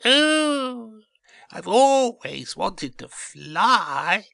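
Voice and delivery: very high, loud eager little seed; slow, high-pitched thrilled squeal; excited cry. A narrator's reading. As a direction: cry out with delight, stretching the words. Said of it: "cried Bert excitedly".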